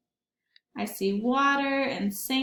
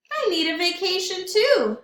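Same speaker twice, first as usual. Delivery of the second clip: higher, louder cheeky reply; crab voice; 7 semitones higher, 4 dB louder than usual. Acted. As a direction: announce it with a grin. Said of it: "said Crab".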